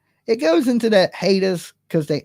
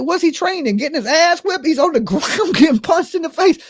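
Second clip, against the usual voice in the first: high incredulous retort; 11 semitones higher than usual. Retold or imitated. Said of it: "I was like".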